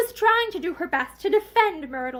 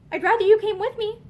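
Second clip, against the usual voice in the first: quick; 6 semitones higher, 5 dB louder than usual. A narrator's reading. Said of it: "she said quickly".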